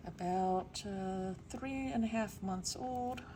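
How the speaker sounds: usual speaking voice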